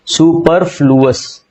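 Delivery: Superfluous said slowly, each syllable spelled out clearly; slow careful word. Shown as a demonstration. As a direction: draw out superfluous for students to copy. (Superfluous को धीरे-धीरे, हर अक्षर साफ़ बोलो)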